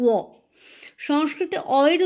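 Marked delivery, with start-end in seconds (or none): 0.1-0.2 ও as a gliding "wo", not b; Sanskrit antastha ba. Shown as a demonstration. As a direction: say ও as a rounded w sound, "wo". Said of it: "অন্তস্থ বয়ের উচ্চারণ".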